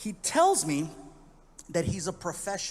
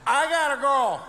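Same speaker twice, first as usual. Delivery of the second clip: put-on louder, very slow drunk slur; drunken slur; 4 semitones higher, 10 dB louder, much slower than usual. Drunk comic announcing he must leave, slow slurred. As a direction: drag it out drunk.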